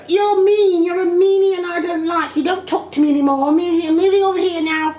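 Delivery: very high-pitched petulant voice; squeaky mock tantrum; mock disgruntled girlfriend. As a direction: mimic a childish huff way up high. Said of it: "almost like a disgruntled girlfriend".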